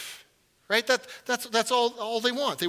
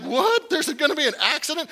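high alarmed cry of disbelief; hammed-up shock at the word accident; mock alarm; 8 semitones higher, 4 dB louder than usual. Passenger hears the safety drill, alarmed. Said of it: "You're just like".

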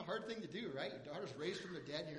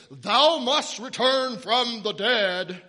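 much louder, slow, pompous biblical decree; grand King James; 5 semitones higher, 20 dB louder, much slower than usual. Pretend. Mocking a lofty King James Jesus, slow and booming. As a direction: declaim it like a stiff old scripture reading. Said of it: "read it from the King James Version".

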